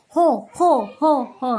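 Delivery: loud hammed-up dog bark; playful dog barking for a children's story; mock barking. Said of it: "Like he is barking".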